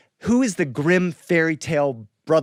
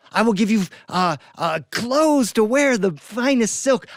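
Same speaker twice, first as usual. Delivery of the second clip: high, loud, slowed eager offer; eager princess offer; 8 semitones higher, 4 dB louder than usual. Acted. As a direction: sweeten the deal, stretching the words.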